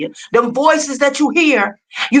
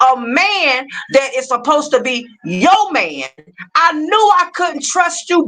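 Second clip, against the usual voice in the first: rising anger; 7 semitones higher than usual. Real, not acted. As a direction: let the indignation climb line by line. Furious rant at accuser on live.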